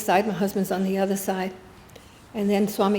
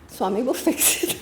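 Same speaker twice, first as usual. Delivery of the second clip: laughing; 3 semitones higher than usual. Retold or imitated. Swami smiling, she laughs.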